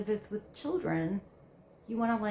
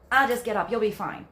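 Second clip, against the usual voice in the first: dismissive brush-off; 8 dB louder than usual. Retold or imitated. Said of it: "totally ignoring that situation".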